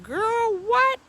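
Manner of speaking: put-on high, loud shocked girl what; shocked outburst. Hearing the neighbor keeps a snake, a loud high shock. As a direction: jump way up in disbelief. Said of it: "I said".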